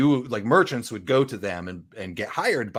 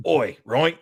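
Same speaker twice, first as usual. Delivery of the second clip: quasi-English ruffian; 5 semitones higher, 3 dB louder than usual. Retold or imitated. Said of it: "all my ruffians are like".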